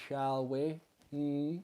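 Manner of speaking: usual speaking voice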